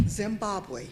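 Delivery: ordinary talk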